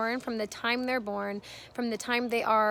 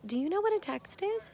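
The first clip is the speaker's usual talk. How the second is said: acted-out sister's question, higher pitched; higher, mock-incredulous child's question; daughter's questioning; 9 semitones higher than usual. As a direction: mimic a sister quizzing her little brother, lilting up. Daughter quizzes her little brother.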